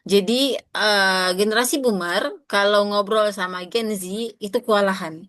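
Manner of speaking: ordinary delivery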